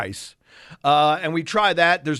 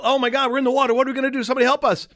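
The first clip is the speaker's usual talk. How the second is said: higher, hammed-up cry for help; mock panic; 7 semitones higher, much faster than usual. Husband retelling panic in the water, mock alarm. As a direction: play the panic, knowing it's silly.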